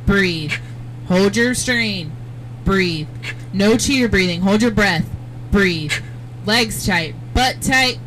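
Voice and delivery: drill-sergeant style coaching shouts; loud coaching calls. Real, not acted. Coach barking calls during centrifuge run.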